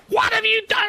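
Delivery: very high piercing retold shriek; mock furious scream, very high. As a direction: shriek it like a banshee down the phone. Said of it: "come screaming".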